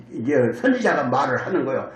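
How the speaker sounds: ordinary talk, nothing marked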